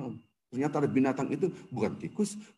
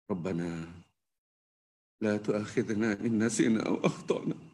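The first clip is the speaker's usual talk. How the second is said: Quran recitation; 7 semitones lower, 6 dB quieter than usual. Acted. Leads Al-Fatihah recitation.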